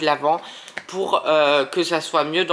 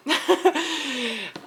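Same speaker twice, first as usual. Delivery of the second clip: high-pitched burst of laughter; 8 semitones higher than usual. Real, not acted. Tarot reader amused after promising healing, high laugh. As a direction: laugh out high, trailing downwards.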